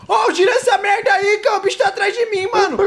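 frantic high loud yelling; panicking gamer. Real, not acted. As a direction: yell for help while being chased. Monster chasing, yelling at friend.

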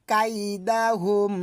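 কাইদাহুম drawn out very slowly in recitation voice. Shown as a demonstration. Teacher recites the verse ending. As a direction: chant কাইদাহুম slowly, each syllable stretched. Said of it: "আমরা বলবো".

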